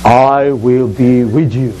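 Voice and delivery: low, slow and solemn divine promise; voice of the Lord. Acted. The Lord answering Moses, low, slow and solemn.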